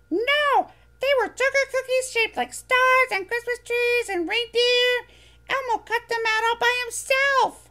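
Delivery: high, bright little furry monster voice, eager; squeaky childlike monster, proud; Elmo voice. Acted. Elmo explaining.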